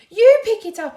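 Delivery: high, loud panicked cry; cried out. A narrator's reading. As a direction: yelp it out in alarm. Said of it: "he cried".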